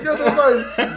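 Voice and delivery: high, laughing meek reply; meek recruit. Retold or imitated. Recruit answering the drill sergeant, sheepish. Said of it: "He's like".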